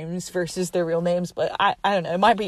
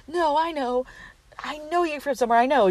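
higher-pitched mock insisting; insistent; 6 semitones higher, faster than usual. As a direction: insist you know them, pitched up. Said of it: "like, insisting".

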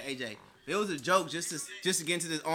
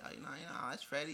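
very soft, smooth come-on; quiet, suave pickup patter; sweet talking; 3 semitones lower, 13 dB quieter than usual. Acted. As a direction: turn on the charm, hushed. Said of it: "I be had to turn up on her".

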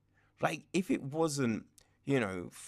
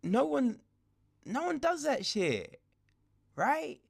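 incredulous; 11 semitones higher than usual. Real, not acted. Incredulous reaction to the scene.

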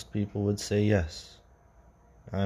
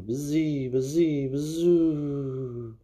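wind noise; 6 semitones higher, much slower than usual. A narrator's reading. Wind noise in ghost story.

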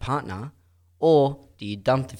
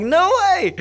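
high loud no way; amazed; 6 dB louder than usual. Retold or imitated. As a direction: squeal it out in disbelief. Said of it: "I'm like".

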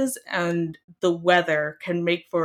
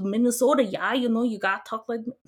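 higher, quick, badly faked Minnesotan accent; terrible Minnesotan accent; 5 semitones higher, faster than usual. Imitating mom falling back into home speech, bad Minnesotan accent. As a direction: imitate a relative slipping into Minnesotan. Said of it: "terrible representation of a Minnesotan accent".

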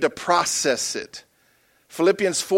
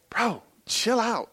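mild laid-back calm; 4 semitones higher, 6 dB quieter, much slower than usual. Retold or imitated. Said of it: "That's how he talks to me".

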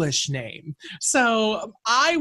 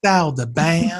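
fake French accent; 4 dB louder than usual. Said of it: "do it in an accent like".

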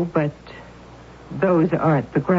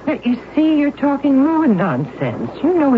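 aunt scolding her niece for nonsense, high and exasperated; 11 semitones higher than usual. Acted.

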